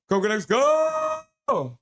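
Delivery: high chant with long held ending; cheering shout. Acted. Streamer finishing a viewer's team chant, cheering shout. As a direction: shout it high and stretch the last word. Last, biggest repeat of chat chant.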